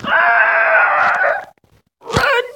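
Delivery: very high, loud shriek; panicked scream. Real, not acted. Gamer fleeing a bigger player, panicked scream.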